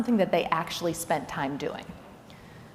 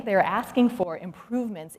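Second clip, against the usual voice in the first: ordinary talk, nothing marked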